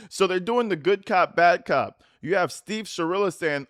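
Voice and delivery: ordinary talk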